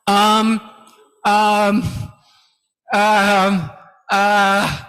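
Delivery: hesitant filler demo. Shown as a demonstration. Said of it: "The speaker says something like".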